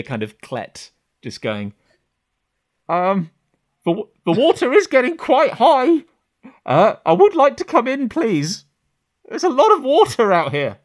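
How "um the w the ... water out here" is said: much higher, louder, polite plea to be let in; a creature putting on a harmless person's voice; pleading monster act. Acted. Clet behind the door politely begs to come in. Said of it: "This is a hell of an act".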